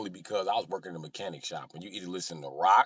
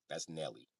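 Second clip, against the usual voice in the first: lower, soft deadpan reply; low-key answer; 4 semitones lower, 13 dB quieter than usual. Retold or imitated.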